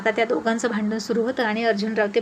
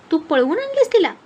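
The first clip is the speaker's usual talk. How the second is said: high, loud disbelieving cry; shocked; 6 semitones higher, 4 dB louder than usual. A narrator's reading. Arjun sir hearing of the elopement, high and loud shock. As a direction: hit it with outraged disbelief.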